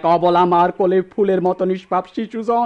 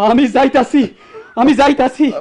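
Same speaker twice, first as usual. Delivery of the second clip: higher, louder, I'm leaving; anguished outburst; 6 semitones higher, 4 dB louder than usual. Acted. Son walking out on his father, raised and anguished. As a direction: cry it out as he storms off.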